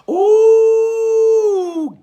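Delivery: high, loud drawn-out "Ooh"; delighted ooh. Real, not acted. Reacting to Candace Lewis's comment, a high delighted ooh. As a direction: let a thrilled ooh ring out. Reacting to his own line.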